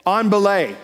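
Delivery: firm call with falling end on "belay"; climber's call. Retold or imitated. Climber calls down to the belayer, called out. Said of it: "and they say".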